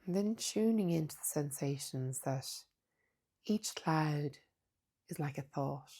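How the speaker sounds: soft, unhurried meditation voice with long pauses; hushed, calm mindfulness guidance; guided meditation. Acted. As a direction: guide them gently inward, leaving space between phrases.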